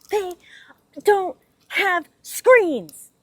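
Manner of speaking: slow, high, loud exasperated whine; complaining about windows with no screens, exasperated; annoyed drawn-out complaint. Real, not acted. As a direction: stretch it out in annoyance. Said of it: "It's very annoying".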